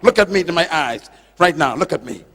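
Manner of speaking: usual speaking voice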